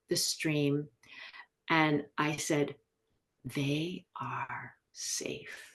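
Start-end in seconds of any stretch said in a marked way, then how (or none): 3.4-5.6 very slow, near-whispered declaration; retold mantra, breathed word by word; hushed affirmation. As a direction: whisper it slowly, with total conviction. Retold affirmation from the stream's edge.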